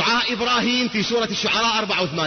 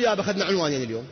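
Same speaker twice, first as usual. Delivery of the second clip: quick mock complaint; 4 semitones lower, faster than usual. Quoting audience complaint, fast.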